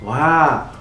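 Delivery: usual speaking voice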